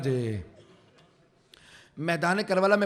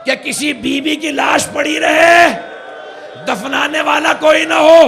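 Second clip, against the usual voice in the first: slow, much higher and louder wailing cry; sermon climax shouted in sorrow; anguished shouted lament; 8 semitones higher, 8 dB louder, slower than usual. Acted. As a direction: cry it out to the whole gathering in grief. Climax of recited prayer.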